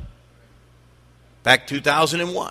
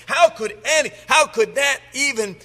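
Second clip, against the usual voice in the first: incredulous listener; 6 semitones higher than usual. Acted. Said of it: "you say".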